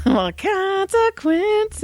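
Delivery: high, drawn-out taunt; mock singsong. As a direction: sing it out slowly, teasing. Mother gloats at son.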